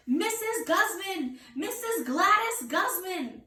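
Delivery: high, slow, loud drawn-out call. Acted. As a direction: bellow her name across the room. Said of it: "I hollered her name even louder".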